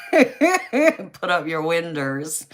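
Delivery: slow, laughing Southern drawl on "Put up your winders"; mock Southern accent. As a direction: drag it out slowly in a playful Southern accent while laughing. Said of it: "they say winders".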